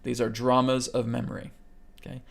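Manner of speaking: normal talking voice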